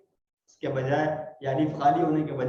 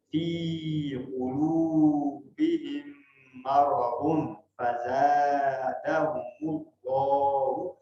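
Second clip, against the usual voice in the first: very slow chanted recitation; drawn-out tajweed recitation; Quran recitation; much slower than usual. Shown as a demonstration. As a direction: recite it slowly with long vowels. Recites the verse.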